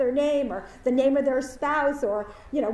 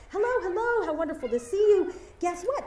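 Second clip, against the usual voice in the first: excited students; 7 semitones higher than usual. Retold or imitated. Excited students greeting teacher.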